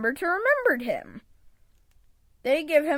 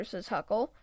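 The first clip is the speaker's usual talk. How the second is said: ordinary delivery, nothing marked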